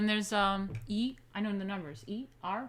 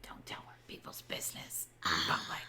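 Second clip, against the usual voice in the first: low, hushed whisper; 8 dB quieter than usual. Real, not acted. Adult telling child not to share private business, whispered. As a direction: whisper a quick reproof.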